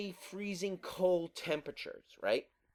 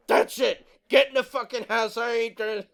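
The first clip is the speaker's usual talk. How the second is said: louder, fed-up father yelling; angry dad; 5 semitones higher, 7 dB louder than usual. Retold or imitated. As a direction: bark it like a fed-up dad ordering kids in.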